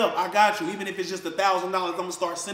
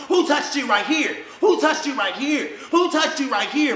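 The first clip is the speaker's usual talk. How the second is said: higher, louder, repeated interrogating yell; mock screaming mother; 7 semitones higher, 6 dB louder than usual. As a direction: bark the question like a furious mother grilling a child. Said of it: "Screaming at her".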